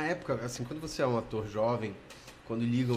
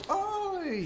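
a much higher, bright "Oi!"; eager phone hello. Retold or imitated. Young actor picking up a call from Globo, eager. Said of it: "E aí eu falei logo".

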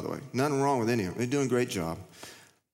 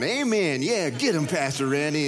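mock cheering amen; 3 semitones higher than usual. A churchgoer egging on the pastor, mock cheering.

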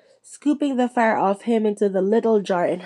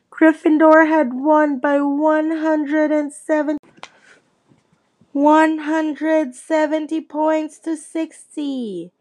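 high, slow, exultant announcing; happy announcer shout; 6 semitones higher, slower than usual. Acted. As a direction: proclaim the score like a stadium announcer. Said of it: "Lee Jordan was happily shouting the results".

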